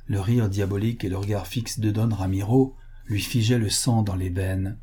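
ordinary delivery

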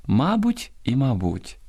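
мабуть first stressed on МА-, then on -БУТЬ; double stress. Shown as a demonstration. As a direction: slowly show both stresses of мабуть. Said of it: "мають подвійний наголос". (два наголоси: мабуть на першому, потім на другому складі)

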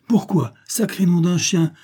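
high, indignant retort with an oath; indignant outburst. A narrator's reading. Husband indignantly throws question back, swears.

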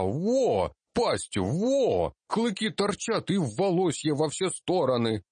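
put-on high excited kid describing monsters; a little kid making excuses; child gnome voice. Гномик оправдывается перед дядей. (детский взволнованный голос)

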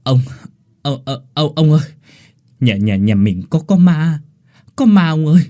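stuttering, rising to a loud fearful plea; stammer, then blurt it out in fear; frightened stammering. Acted. Terrified servant boy stammers to his old master about a ghost. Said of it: "hoàn hồn lắp bắp".

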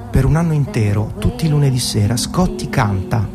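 normal talking voice

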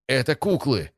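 high alarmed cry; startled shout. A narrator's reading. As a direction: blurt it out in shock. Realization shouted in battle.

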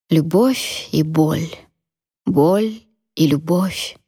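drawn out, each word weighed; slow, pained musing. A narrator's reading. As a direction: let each word hang slowly.

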